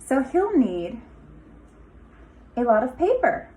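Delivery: lively storybook read-aloud with a sing-song lilt; picture-book voice with rising, inviting phrases; reading to children. Acted. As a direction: read it like story time for a class of little kids. Teacher reads picture book aloud.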